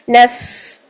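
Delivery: نس ending in a continuous hiss; flowing whistled seen. Shown as a demonstration. Teacher showing breath flows in seen, نس held. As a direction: keep breath running through the s of نس. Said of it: "آواز جاری اور سیٹی بھی".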